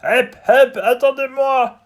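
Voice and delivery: high, loud frog voice, slow; frog calling out. Acted. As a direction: call after them so they wait. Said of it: "crie une grenouille".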